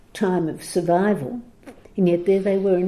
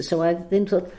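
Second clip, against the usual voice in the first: normal talking voice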